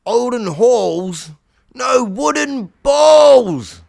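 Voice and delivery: exasperated roar; shouted correction. Acted. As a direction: bellow it word by word at the deaf dragon. Shouting correction to deaf dragon.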